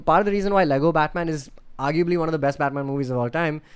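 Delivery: normal talking voice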